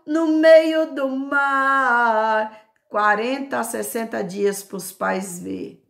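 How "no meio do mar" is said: loud, slow, half-sung proclamation; exultant preaching. Acted. God promising to open ways, exultant preaching. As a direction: stretch the promise out like a chant.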